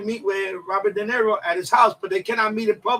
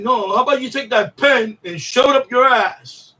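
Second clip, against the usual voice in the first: louder, jeering put-down; mock insult shout; 6 dB louder than usual. Rude guy at the bar telling De Niro off, loud mock jeer. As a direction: shout the insult back at him.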